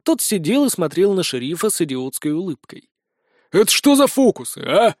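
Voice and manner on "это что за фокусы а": sharp high demand, voice raised; angry sheriff. Acted. Sheriff confronting the prisoner in his cell, angry.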